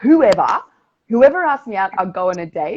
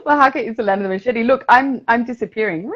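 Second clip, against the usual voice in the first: giddy laughing talk; 7 semitones higher, 5 dB louder than usual. Real, not acted.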